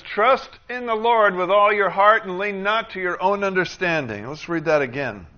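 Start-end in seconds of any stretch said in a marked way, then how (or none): 0.0-4.3 loud, raised-pitch Bible reading; scripture proclamation. Acted. Preacher reading Proverbs to the congregation, proclaiming loudly. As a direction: proclaim the verse out to the room.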